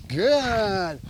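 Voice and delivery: GOOD stretched long and falling; drawn-out duration marker. Real, not acted. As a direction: hold "good" out slowly to the dog. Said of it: "We're doing the duration marker".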